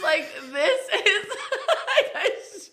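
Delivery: high, helpless laughter through the words; cracking up. Real, not acted. Storyteller cracking up over her own bad decision, laughing. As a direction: break up laughing mid-sentence.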